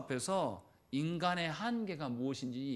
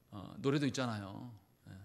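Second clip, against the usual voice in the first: usual speaking voice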